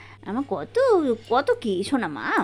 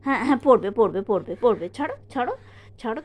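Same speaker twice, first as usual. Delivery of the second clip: ordinary talk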